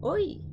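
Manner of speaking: OI swooping high then down; one-syllable up-and-down glide on "oi"; circumflex rise and fall. Shown as a demonstration. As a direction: lift "oi" high and let it drop. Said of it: "up and down".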